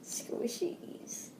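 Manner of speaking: unvoiced whisper. Real, not acted. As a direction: breathe the words. Naming the squishies.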